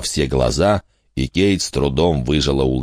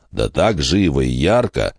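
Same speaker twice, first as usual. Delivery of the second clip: usual speaking voice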